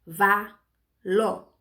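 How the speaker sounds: "ca", "vá", "ló" each alone, with pauses; three isolated, evenly weighted beats; sounding out syllables. Shown as a demonstration. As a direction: lay out each syllable separately. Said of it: "Aí formou as sílabas".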